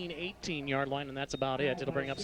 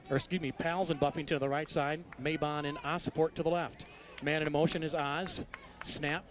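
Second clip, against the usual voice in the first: ordinary talk, nothing marked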